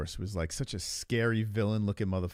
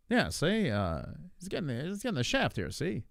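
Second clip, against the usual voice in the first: Tom Aspinall; 3 semitones higher than usual. Acted. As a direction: ham up an old-time newsreel talker. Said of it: "Tom's got that w-, voice".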